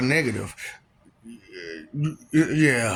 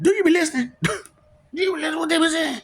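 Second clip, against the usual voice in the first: mock taunt; 12 semitones higher, much faster than usual. Mocking repeated question.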